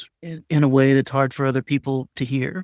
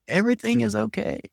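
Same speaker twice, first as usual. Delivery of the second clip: coaxing higher reassurance; stronger soothing self-talk; 8 semitones higher than usual. Retold or imitated. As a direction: lift the voice to comfort on Everything is okay. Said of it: "And now I'm just like".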